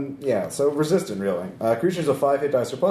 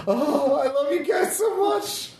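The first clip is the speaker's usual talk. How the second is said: overflowing with love for the party; gushing; slower than usual. Pretend. Charmed item, loyal to party.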